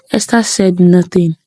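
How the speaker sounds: usual speaking voice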